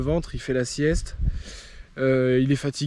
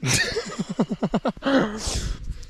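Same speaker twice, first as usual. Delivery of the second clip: high burst of laughter; 8 semitones higher than usual. Real, not acted. Bursts out laughing after punchline.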